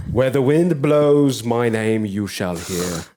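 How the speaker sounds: slow, theatrical proclamation; mock-grand herald. Grandly introducing oneself to newcomers, slow and theatrical. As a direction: intone it like a legendary figure.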